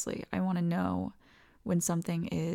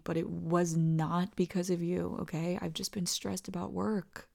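normal talking voice

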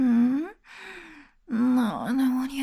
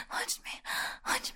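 normal talking voice

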